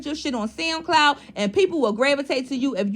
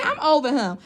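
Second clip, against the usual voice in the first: ordinary talk, nothing marked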